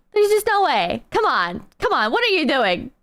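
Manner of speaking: ordinary delivery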